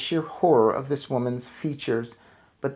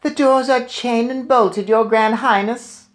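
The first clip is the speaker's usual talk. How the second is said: 1 witch in audience; 11 semitones higher, 6 dB louder than usual. A narrator's reading. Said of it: "answered a voice in the audience".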